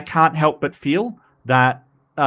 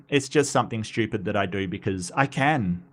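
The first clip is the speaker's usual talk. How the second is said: normal talking voice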